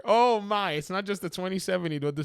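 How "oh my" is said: mock disbelief. Real, not acted. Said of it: "disbelief at the spec".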